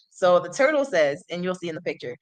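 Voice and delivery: ordinary delivery, nothing marked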